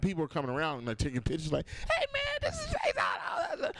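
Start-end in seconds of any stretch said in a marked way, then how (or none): 1.9-3.7 very high, squealing mock shout; excited onlooker. Comedian mimics fans snapping pictures, high excited shout. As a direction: imitate a bystander calling out in a piercing falsetto.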